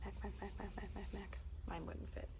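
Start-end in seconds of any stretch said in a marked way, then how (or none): none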